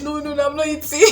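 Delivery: dramatic refusal. Retold or imitated. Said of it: "I said".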